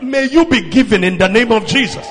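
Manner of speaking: loud shouted declaration; emphatic preached blessing. Real, not acted. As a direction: declare it forcefully over the congregation. Repeats blessing at full force.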